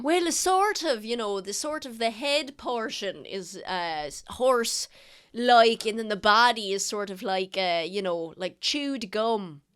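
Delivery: put-on eager old sailor. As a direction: gush about sea creatures like a proud old sailor. GM voices the sailor husband.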